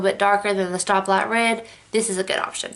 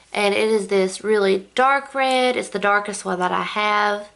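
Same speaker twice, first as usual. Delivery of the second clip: normal talking voice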